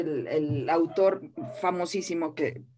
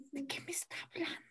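whispered inner panic, barely voiced; confused whisper; 7 semitones higher, 15 dB quieter, much faster than usual. A dubbing actress whispering her confusion. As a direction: whisper it to herself, lost and scared. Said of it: "Yo decía".

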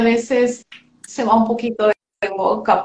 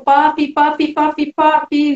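calling out; 5 semitones higher, 3 dB louder than usual. Retold or imitated.

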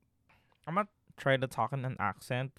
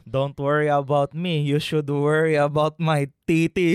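loud, hammed-up Filipino accent; 10 dB louder than usual. Joker bragging to co-host for laughs, loud mock Filipino accent. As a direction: boast loudly in a thick Filipino accent. Joking demo of sexiest accent.

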